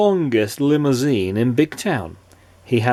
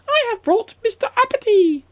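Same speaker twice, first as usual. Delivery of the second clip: very high, squeaky goblin voice. A narrator's reading. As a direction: announce it in a tiny creature's pipe. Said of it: "said the goblin".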